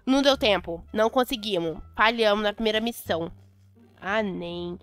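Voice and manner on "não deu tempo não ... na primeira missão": usual speaking voice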